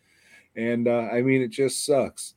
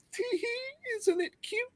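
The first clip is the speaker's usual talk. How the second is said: tiny, very high, soft titter of a voice; mock cutesy; 7 dB quieter than usual. Mocking filmmakers pleased with their footprint gag, mock cutesy. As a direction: squeak it sweetly in mocking falsetto.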